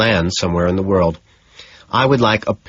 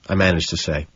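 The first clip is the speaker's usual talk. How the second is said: ordinary delivery, nothing marked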